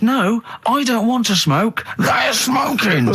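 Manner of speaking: high, slow, hammed-up shouty protest; exasperated retelling. Diner repeating himself to the waitress, mock exasperated shout. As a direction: drag out each word, climbing into a mock shout. Retold escalated reply to waitress.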